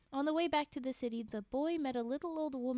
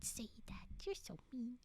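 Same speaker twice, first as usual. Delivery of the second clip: whispering; 10 dB quieter than usual. Real, not acted. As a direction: scold in a breathy whisper. Whispered playful scolding.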